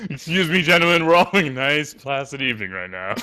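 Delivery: play-scold the table, high then settling; mock indignation. Player interrupting gentlemen over the running joke, in mock indignation.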